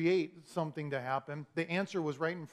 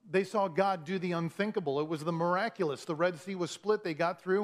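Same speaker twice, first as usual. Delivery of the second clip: ordinary talk, nothing marked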